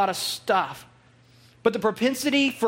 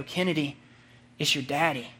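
tender; 6 semitones lower, 4 dB quieter than usual. Retold or imitated. Father greets newborn daughter.

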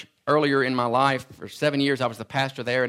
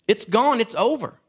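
normal talking voice

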